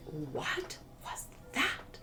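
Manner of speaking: slow breathless whisper of amazement; stunned hush. Retold or imitated. Bartender stunned after her karaoke song, whispered slowly. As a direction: hush it out, floored by what you just heard.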